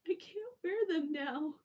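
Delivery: high, loud upset cry; dismayed. Retold or imitated. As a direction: wail it in dismay. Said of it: "I was like".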